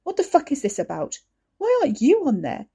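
furious tirade. Acted. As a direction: brother tearing into Ricky. Wes berates Ricky.

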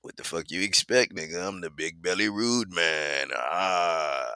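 Dizzle. Retold or imitated. Friend answering back on the phone, low imitated boast. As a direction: imitate a friend's lazy, unbothered comeback. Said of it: "It's me and Dizzle on the phone".

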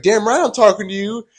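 high, loud imitation of his retort; man's retold yell. Stranger snapping back at the teller, imitated yell. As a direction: mimic him yelling, pitch pushed up. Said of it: "a man's voice yells back at me".

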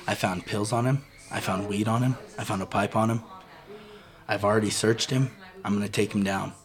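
low, soft, matter-of-fact listing; retold deputy's report. Deputy reporting the finds to dad, low deadpan imitation. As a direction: imitate a straight-faced officer reporting.

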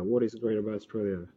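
ordinary talk, nothing marked

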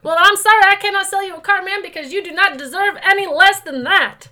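high, loud, pushy car dealer; oily salesman steamrolling the buyer; sleazy salesman. Acted. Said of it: "my used car salesman voice".

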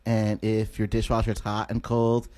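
awkward unpolished comedian. Retold or imitated. Comedian mocking his own set beside polished visitors, awkward low monotone. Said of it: "And then I'm all just like".

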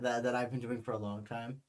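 usual speaking voice

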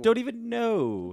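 high silly voice. Pretend. Echoing silly voice.

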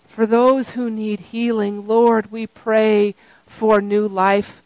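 low, hushed prayer voice. Acted. Pastor praying over the congregation, hushed and reverent.

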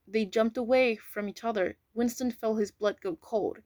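plain reading voice

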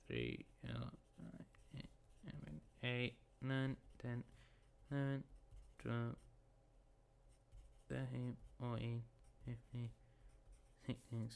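muttered, near-whispered counting; counting under breath. Real, not acted. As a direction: count quietly to yourself. Counting table places under breath.

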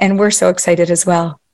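ordinary talk

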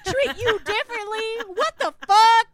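high, loud indignant yelling; loud mock fury at the date; outraged yell. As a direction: demand it in disbelief. Continued outraged rant.

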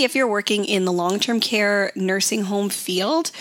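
normal talking voice

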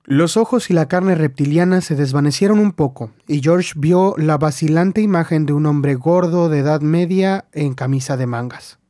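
usual speaking voice

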